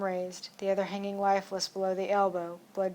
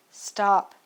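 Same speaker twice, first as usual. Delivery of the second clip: shouted; 7 dB louder than usual. Acted. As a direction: shout to halt the attacker. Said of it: "I shouted again".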